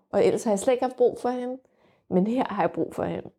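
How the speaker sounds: normal talking voice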